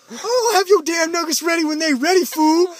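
high, loud sassy cashier voice; mock the sassy cashier, high and loud. Cashier snapping at the kids, high sassy voice.

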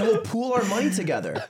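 lightly voiced eager friend, laughing through it; mock excited girlfriend. Retelling Zipper's Super Bowl plan to friends, giggly mock eagerness.

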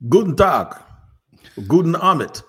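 loud, showy German hello; German greeting. Acted. Host shows off his German to his co-host, in a showy German accent. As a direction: greet them in German, showing off. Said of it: "doing these German accents".

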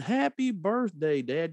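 high cheerful birthday greeting, stretched out; cheerful exuberant. Retold or imitated. Son phoning his father in hospital, high and cheerful. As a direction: sing it out like good news. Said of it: "just in my most cheerful, exuberant voice I could".

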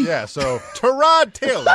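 laughing. Real, not acted. Laughing after the banter.

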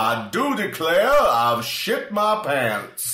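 high, slow, grand Southern drawl; Southern gentleman Mark Twain. Retold or imitated. Host voicing Mark Twain to Tesla, slow grand Southern voice. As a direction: declare it like a proud old Southern gentleman. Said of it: "just announcing with his thumbs in the lapels".

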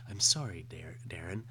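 low, hushed machine-like apology; calm computer refusal. Acted. HAL computer parody refusing Darren.

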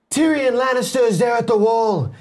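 high, strained shout in Jon Snow's accent. Shown as a demonstration. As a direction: pitch the Jon Snow voice way up and project it. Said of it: "higher pitch as".

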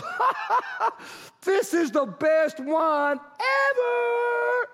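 a wine taster laughing in amazement at the best wine; delighted laugh. Acted. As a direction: burst out laughing at wine this good.

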